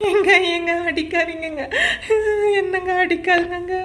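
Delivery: high, tearful mock wailing as if being beaten; fake crying plea. Wife acts beaten for the neighbours outside, high mock sobbing. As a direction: play the wronged wife with hammed-up sobs. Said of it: "நடிப்புக்கு Oscar அவார்டே வாங்குவோம்".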